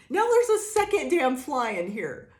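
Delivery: loud, high, fed-up complaint; exasperated outburst. Real, not acted. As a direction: blurt it out in disbelief. Outburst at a second fly.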